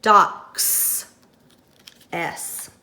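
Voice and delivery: "Docks." with a drawn-out voiceless S at the end; hissing S ending. Shown as a demonstration. Teacher answers her own question with a hiss. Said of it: "What sound do you hear on the end?".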